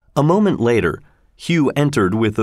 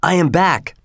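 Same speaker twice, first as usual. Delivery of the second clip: higher, glad homecoming call; happy announcement; 7 semitones higher than usual. A narrator's reading. Hendon tells his brother he is home, happily. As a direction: announce your return happily. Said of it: "Hendon said happily".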